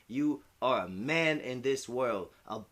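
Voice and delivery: slow, deliberate quoting of mom; a slow, weighty hint of mom; mom's serious words. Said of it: "She's like".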